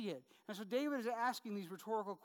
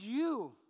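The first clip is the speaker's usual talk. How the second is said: YOU said louder with weight; emphatic you; 6 dB louder than usual. Shown as a demonstration. Pastor pointing out David's emphatic word, with emphatic stress on You. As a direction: land "You" hard and emphatic. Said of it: "very emphatic".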